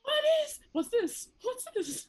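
high amazed wonder; wide-eyed at the snow; childlike wonder. Acted. Character seeing snow first time.

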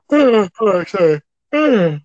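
talking through a yawn. Real, not acted. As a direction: let the yawn stretch the words. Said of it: "always do that".

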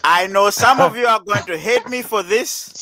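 loud, high mock-Nigerian announcement; Nigerian accent. Host announcing to all the Nigerians, hammed-up Nigerian accent. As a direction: declaim slowly in a put-on Nigerian accent. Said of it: "you have to have a Nigerian accent".

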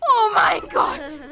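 high, loud, drawn-out cry; shouted dismay. Real, not acted. Player yelling at a mishap in the game, shouted dismay. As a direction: wail it out slowly at full voice.

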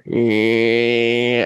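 sustained low droning "ehhh"; imitated buzz. Bassist showing how dimmed lights sound, steady buzzing drone. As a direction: mimic a dimmer's electrical hum. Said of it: "it'll stop that buzz".

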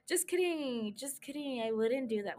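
soft, trailing just-kidding aside; sheepish retraction. Real, not acted. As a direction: drop your volume and take it back. Backing off a bold joke.

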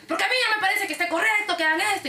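high-pitched indignant imitation; retold angry protest. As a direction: argue back as the irate customer, without shouting.

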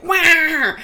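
high, sharp impatient question; mock impatient boss. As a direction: bark it like the snappy boss again. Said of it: "she was like".

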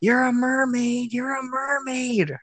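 imitating an excited kid's cry, very high and drawn out; high-pitched, stretched-out pretend scream; mock scream. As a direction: squeal it slowly way up high, without real volume. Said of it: "screaming something like".

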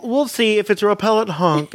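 plain reading voice

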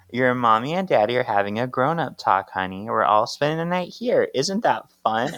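lilting mock-cheerful parent voice; acted coaxing, talking to little kids; mother to children. Piper placates her tired kids, coaxing mom voice.

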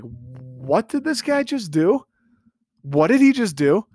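drawn out, rising incredulous question; stunned fan reaction, voice swooping up; astonished disbelief. Retold or imitated. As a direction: replay your amazement at what you just watched. Reenacts his astonished reaction to throw.

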